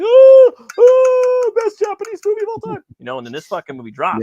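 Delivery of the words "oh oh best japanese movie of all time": very high, loud mock sobbing fan; mock crying fan. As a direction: wail it like a weepy fan in the theater. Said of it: "people are crying".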